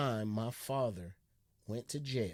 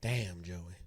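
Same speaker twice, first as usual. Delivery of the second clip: ordinary talk, nothing marked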